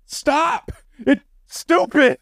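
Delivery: high, drawn-out cry of exasperation; exasperated outburst. Real, not acted. Podcaster fed up with a stupid plot, exasperated. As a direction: stretch each word out in a high-pitched protest.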